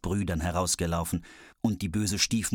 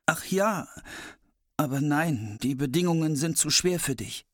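brother's voice; 6 semitones higher than usual. Acted. Brother explains the conditions.

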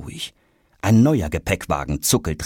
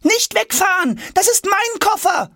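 desperate shout. A narrator's reading. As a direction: cry out to stop the cart. Jonas chasing case.

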